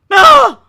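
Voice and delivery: sharp, very loud frustrated shout; short angry scream; frustrated yell. Real, not acted. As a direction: blow up for a second. Frustrated outburst.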